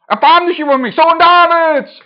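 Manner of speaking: very high, slow desperate scream. Acted. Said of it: "er aber schrie noch viel mehr".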